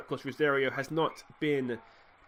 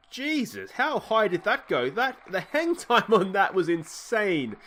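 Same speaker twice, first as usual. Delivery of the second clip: amazed; 5 semitones higher, 3 dB louder, slower than usual. Real, not acted. Towering pop-up finally caught.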